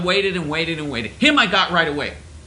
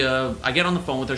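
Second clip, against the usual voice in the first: usual speaking voice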